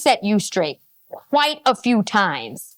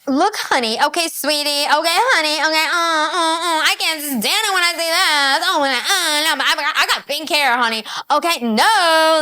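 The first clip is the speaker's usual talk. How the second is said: hammed-up sassy feminist impression, pitched higher; mocking feminist talk; 5 semitones higher than usual. Host imitating how feminists talk, high sassy mockery. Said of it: "Why do feminists talk like that?".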